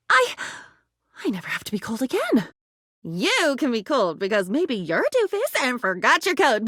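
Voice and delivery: ordinary talk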